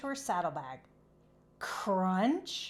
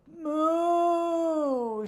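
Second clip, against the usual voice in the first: long high loud moo; moaning moo; 8 semitones higher, 6 dB louder than usual. Acted. Cow in labour moaning, long drawn moo. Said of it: "she moaned".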